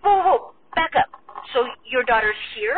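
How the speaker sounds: high, loud whoa of shock, then the question; stunned surprise. Real, not acted. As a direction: stop him mid-story, caught off guard. Stunned by news of baby.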